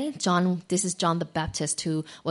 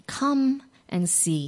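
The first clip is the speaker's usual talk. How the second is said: gentle invitation; 6 semitones higher, much slower than usual. Acted. Jesus answers slowly.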